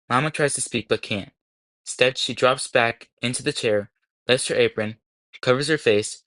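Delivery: plain reading voice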